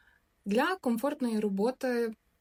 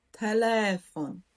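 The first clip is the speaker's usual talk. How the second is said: телефон slowly, stressed on ЛЕ; наголос на передостанній склад; slower than usual. Shown as a demonstration. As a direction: lean on the middle syllable ле in телефон. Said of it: "Наголос падає на передостанній склад". (телефон повільно, з наголосом на ле)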